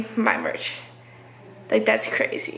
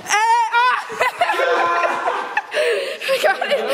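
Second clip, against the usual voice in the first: excited laughing; 10 semitones higher, slower than usual. Real, not acted. Wins a race, laughing excitedly.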